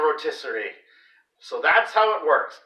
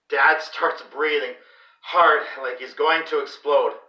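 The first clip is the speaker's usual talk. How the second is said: ordinary delivery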